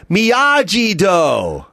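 slow, drawn-out shout; mock movie shout. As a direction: bellow it like the kid in the movie. Mimicking Karate Kid movie line.

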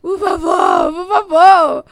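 loud tearful begging, voice breaking; crying plea. Retold or imitated. Stranded traveler begging the queue at the exchange, tearful shout. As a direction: beg through tears, half shouting. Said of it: "Comecei a chorar".

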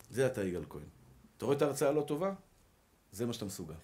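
a low, quiet tempter taunting; voice of evil inclination. Retold or imitated. The evil inclination taunts the preacher in a low, soft voice. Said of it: "מה היצר הרע אמר לי".

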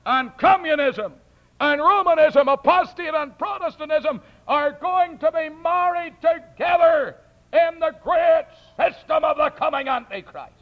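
high, loud pulpit shout; full-force preaching. Real, not acted. Preacher warning the congregation of the Antichrist, full-force preaching. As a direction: thunder it out, stretching the key words.

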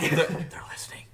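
loud, high creepy whisper; spooky whisper. Pretend. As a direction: hiss it like a paranoid warning. Spooky whisper about devices listening.

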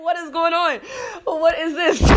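high, half-laughing outrage; laughing disbelief. Retold or imitated. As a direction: laugh through the shock as you ask. Shocked reading boyfriend's messages.

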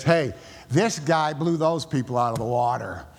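ordinary talk, nothing marked

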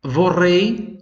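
"Vorrei" drawn out with a rising end and a high start; slow model word. Shown as a demonstration. Teacher models the word for the class, rising. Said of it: "Frase quatro".

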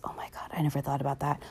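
hushed, breathless whisper; a fast, secret whisper of amazement; awed whisper. Real, not acted. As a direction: whisper as if the idea just struck. Awestruck realization about Luke, Anakin.

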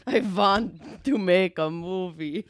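put-on slow Werner Herzog German accent; German accent, slow and solemn. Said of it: "He's just German".

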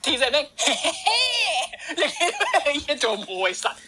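words broken up by laughter; can't stop laughing mid-story; laughing while telling. Real, not acted. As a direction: giggle through the insult. Cracking up retelling the spot.

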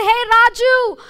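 loud, high call across the office; a passerby shouting bad news after him. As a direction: yell out to him from a distance. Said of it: "someone shouts and says".

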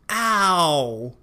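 ow 像撞到手肘时喊痛的 ow; 把 ow 念得像喊痛一样; ow sound. Shown as a demonstration. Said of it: "撞到手肘的时候发出来的声音". (示范 ow 这个母音)

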